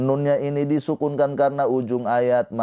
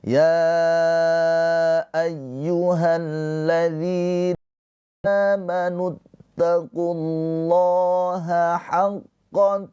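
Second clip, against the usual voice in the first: slow, high, sustained recitation; tilawah Al-Qur'an; 7 semitones higher, much slower than usual. Shown as a demonstration. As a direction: recite slowly, holding each long note. (tilawah pelan dengan nada tinggi)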